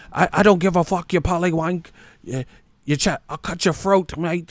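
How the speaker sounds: hammed-up British fan snarling a threat; mock angry British fan. Mocking fans threatening critics, high hammed-up British accent. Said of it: "Then when he runs".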